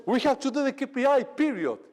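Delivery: a hammed-up pushy manager laying down the rule; bossy manager. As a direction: bark the order like a strict manager. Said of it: "A manager punishes".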